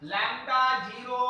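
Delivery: slow, words stretched out; slow motion. Shown as a demonstration. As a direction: say it slowly so they follow. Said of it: "देखो slow motion में बोलेंगे".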